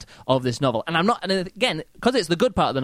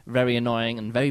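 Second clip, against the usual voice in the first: ordinary delivery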